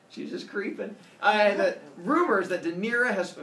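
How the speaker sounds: ordinary delivery, nothing marked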